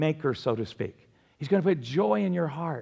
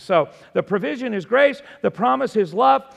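higher, louder, emphatic list of points; full-force preaching; 5 semitones higher, 6 dB louder than usual. Real, not acted. Preacher summing up provision, promise, process and prognosis, full-force preaching. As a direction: drive each point home like a preacher.

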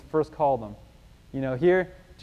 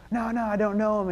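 high-pitched, defensive protest; acted-out flustered denial; insistent denial; 8 semitones higher than usual. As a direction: deny it quickly, pitch jumping up. Peter denies him once more.

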